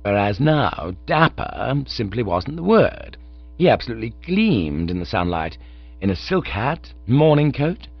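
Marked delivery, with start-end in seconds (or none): none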